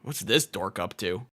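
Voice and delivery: a bully picking on someone smaller; sneering villain. Acted. Villain sizing up a target.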